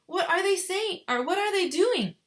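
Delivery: put-on a boy bursting with curiosity; excited cry. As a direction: Charlie pleading with Grandpa, high and excited. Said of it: "cried Charlie".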